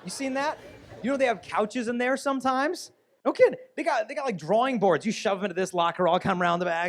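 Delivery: high, loud and amazed; excited. Real, not acted. Telling the teens about girls' bathrooms, loud and amazed. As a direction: pile on the disbelief, voice up high.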